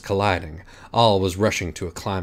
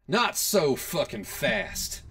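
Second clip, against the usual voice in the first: shouted from afar; 7 semitones higher, 3 dB quieter, much slower than usual. A narrator's reading. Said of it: "a girl's voice shouted from the distance".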